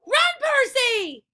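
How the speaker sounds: high, loud, drawn-out shout; urgent shout. A narrator's reading. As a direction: call him away in alarm. Annabeth warning Percy.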